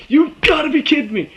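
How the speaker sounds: high, loud outburst of disbelief; re-enacted incredulous yell at the TV; freaking out. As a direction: cry it out in shocked disbelief. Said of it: "I'm freaking out at this point".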